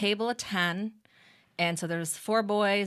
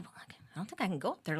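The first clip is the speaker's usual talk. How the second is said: retold whisper; 10 dB quieter, faster than usual. Hushed reply at quiet rehearsal.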